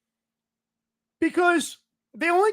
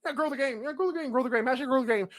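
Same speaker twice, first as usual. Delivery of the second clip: mocking muttered slogan; 3 semitones lower, 8 dB quieter, faster than usual. Shown as a demonstration. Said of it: "That's all we ever say is grow the game".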